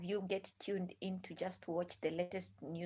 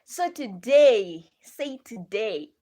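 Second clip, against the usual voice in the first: slow and much louder; emphatic restart; 12 dB louder, much slower than usual. Real, not acted. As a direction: call it out to grab attention.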